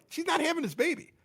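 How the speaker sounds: high, scornful protest that she's faking; scoffing at the false labor; dismissive insistence. Retold or imitated. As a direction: sneer that it's all for attention. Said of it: "I'm like".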